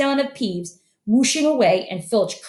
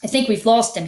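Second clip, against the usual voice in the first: ordinary delivery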